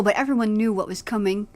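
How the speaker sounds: ordinary delivery, nothing marked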